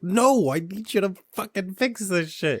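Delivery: high-pitched imitation of an exasperated patient; exasperated refusal. Patient refusing the doctor's drug offer, exasperated. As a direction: push the pitch up in mock frustration.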